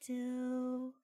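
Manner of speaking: high, soft, sheepish admission; a bashful girl owning up to her crush; sheepish shy girl. Retold or imitated. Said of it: "Just, like, all sheepishly".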